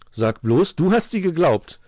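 loud, rising disbelief; surprised. A narrator's reading. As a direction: blurt it out, taken aback. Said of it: "war er überrascht".

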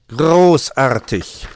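loud, drawn-out praise; praising. A narrator's reading. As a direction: stretch it out with big approval. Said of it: "lobte Meister Schwarzrock".

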